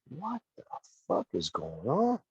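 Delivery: starts high and soft, drops to slow disbelief; retold bewilderment. Wrestler recalling being made to leave, bewildered. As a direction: stretch out your baffled question. Retelling his confusion while leaving.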